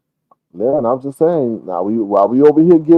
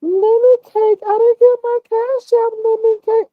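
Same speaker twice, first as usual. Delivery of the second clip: sweet-talking woman. Retold or imitated. Imitating the woman begging Lemon for Cash App, high cooing. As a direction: coo it like she wants money. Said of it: "what did she call him".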